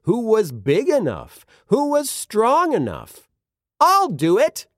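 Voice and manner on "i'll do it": high, eager call. A narrator's reading. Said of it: "a voice called out".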